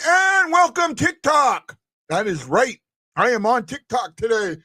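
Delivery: shouted welcome, pitch jumping up; excited shout. Real, not acted. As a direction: call out a big welcome to viewers. Greeting new TikTok viewers.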